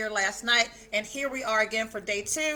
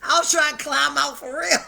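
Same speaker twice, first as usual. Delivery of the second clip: laughing while talking; 6 dB louder than usual. Real, not acted. Host joking with a friend about a late-night text, laughing. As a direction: laugh through the line.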